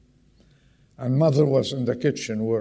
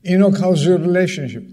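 calling out; 5 semitones higher, 7 dB louder, faster than usual. Real, not acted. Preacher calls out to congregant.

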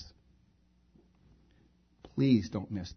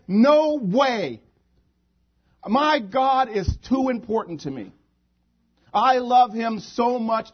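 high, loud, impassioned preaching cry; fervent defiance; 9 semitones higher, 9 dB louder than usual. Acted. Believers refuse to deny their God, fervently defiant. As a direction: cry out the refusal with total conviction.

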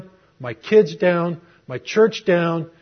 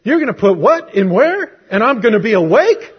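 louder, incredulous with a laugh; mock panic at the news; freaking out; 4 semitones higher, 6 dB louder than usual. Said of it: "I'm freaking out".

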